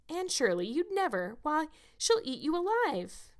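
high, swooping alarm; aghast. A narrator's reading. Diana warns Anne off facing her aunt, aghast. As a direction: warn her in horrified disbelief, drawing out the end.

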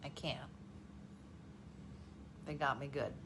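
ordinary talk

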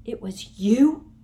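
slow, loud disbelieving gasp; shocked realisation; Harry gasping at Umbridge. A narrator's reading. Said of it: "gasped Harry".

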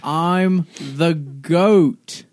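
loud mocking goat. Goat voice mocking the stunned man.

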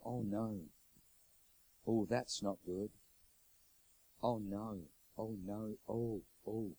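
soft, low, anxious oh no; a nurse seeing trouble on screen; hushed dread under the breath; worried murmur. Retold or imitated. Nurse sees bad news.

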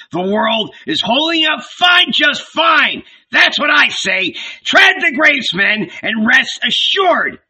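high, exasperated tirade; loud, mocking bluster; shouted. A narrator's reading. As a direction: rant at them, out of all patience. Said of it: "he shouted".

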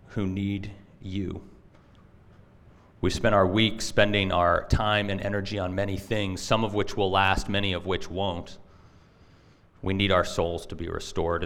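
low-pitched prayer, softening at the close. Real, not acted. Pastor prays to the Father before teaching, low earnest prayer. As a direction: pray low and measured.

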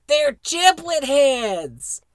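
high, loud, drawn-out imitation of a kid chiming in; Bobby. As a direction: copycat an outburst, stretched high and loud. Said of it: "Bobby chimes in with a".

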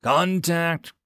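high-pitched combat alert; yelling. A narrator's reading. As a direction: call out a sighting to the squad. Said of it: "the Marine yelled".

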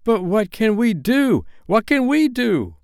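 put-on helpless cry of frightened children; frightened boys. A narrator's reading. Boys panic at wolves.

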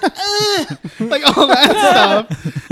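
high, loud, slow mock ugh; laughing mock revulsion; mock disgust. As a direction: ham up a disgusted groan. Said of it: "she's like".

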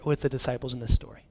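ordinary talk, nothing marked

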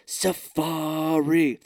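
slow, stretched final safari; half-hearted menacing drawl. Retold or imitated. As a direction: drag out safari like a creepy threat. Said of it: "I couldn't do it very well".

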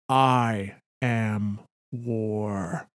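very slow, a hint of a looming god; thunderous voice. Hive queen answering Clovis, very slow and ominous. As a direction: drag out each word like a far-off verdict. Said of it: "The thunder ... responds".

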